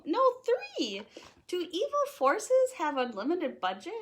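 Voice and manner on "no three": jumps higher on three in mock alarm; sudden alarmed jump up on three. Counting tie fighters, high startled mock alarm.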